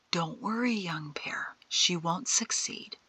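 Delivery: soft, hushed reassurance; calm the young one down gently; quiet, settled comfort from an elder; reassuring. A narrator's reading. Old man reassures agitated Shin.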